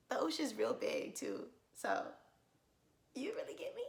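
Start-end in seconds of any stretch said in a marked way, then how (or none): 3.1-3.8 breathy whispered, rising question. Retold or imitated. Asking her date if he'd save her, whispered. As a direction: whisper it like a flirty secret.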